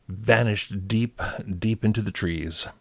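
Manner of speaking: ordinary delivery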